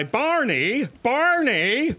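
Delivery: high, swooping cartoon call; imitated cartoon holler, rising at the end; neighbors mimicking Fred Flintstone. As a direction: sing out a mock Flintstone "Barney" in passing. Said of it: "So then my, my neighbors went".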